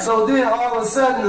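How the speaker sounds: usual speaking voice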